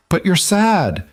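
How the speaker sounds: put-on high, tearful child's protest; a hint of crying into his shoulder; lightly voiced sob. A narrator's reading. Little girl sobs to her father, mildly tearful. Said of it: "Maddie sobbed into his shoulder".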